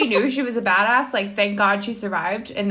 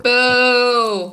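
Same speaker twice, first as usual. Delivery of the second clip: booing; 4 semitones higher, 6 dB louder than usual. Real, not acted. Booing Clarence Thomas.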